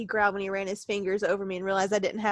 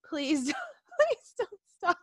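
slow, higher, giggling read; can't keep a straight face; breaking up mid-quote; laughing through the line; 10 semitones higher than usual. Real, not acted.

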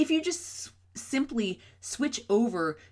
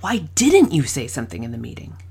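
"didn't" punched as the key word; accusing weight on "didn't"; stress on DIDN'T; 6 semitones lower, 10 dB louder than usual. Shown as a demonstration. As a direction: lean on "didn't" like a reproach. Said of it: "six different ways I said the same question".

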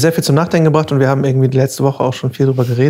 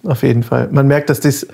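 ordinary talk

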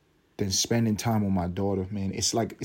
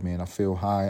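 usual speaking voice

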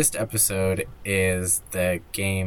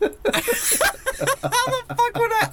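high, slow laughing protest; laughing disbelief; much slower than usual. Real, not acted. Impossible trivia question.

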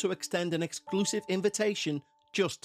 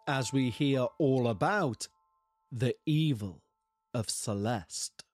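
lower, hushed spooky lead-in; ominous late night radio; 3 semitones lower than usual. Acted. Host leads into scary story.

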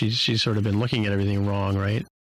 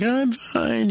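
squeaky, much higher forced fine; nervous denial; 13 semitones higher than usual. Retold or imitated. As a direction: hide panic badly. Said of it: "he's like".

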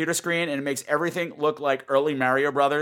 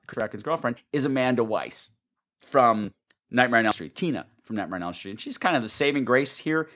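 ordinary talk

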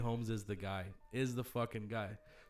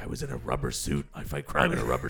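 much lower, rasping gravel Batman growl; 12 semitones lower, 3 dB louder than usual. Retold or imitated. Batman talking to his stabber, gravel growl. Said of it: "Is it the Batman voice?".

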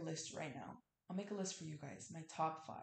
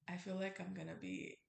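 normal talking voice

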